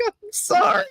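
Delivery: breathless giggled whisper; laughing whisper. Real, not acted. Teen apologizing to the old couple, giggling in a whisper. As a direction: squeak it out through a laugh.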